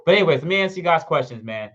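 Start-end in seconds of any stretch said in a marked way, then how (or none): none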